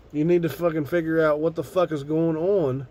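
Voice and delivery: ordinary talk, nothing marked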